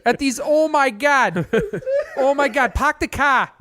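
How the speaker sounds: high, hammed-up Boston-style raving; East Coast accent. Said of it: "we're terrible at this accent".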